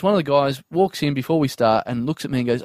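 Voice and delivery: ordinary talk, nothing marked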